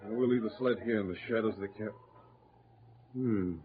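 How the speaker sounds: usual speaking voice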